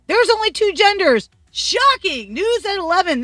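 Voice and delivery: high, loud mock news flash; sarcastic announcement. Telling viewers the news, high and loud mock shock.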